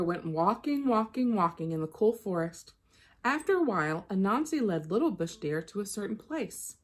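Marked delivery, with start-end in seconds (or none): none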